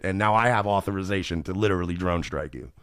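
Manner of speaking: ordinary talk, nothing marked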